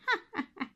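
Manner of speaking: high witch cackle; high evil witch cackle. A narrator's reading. Witch laughs.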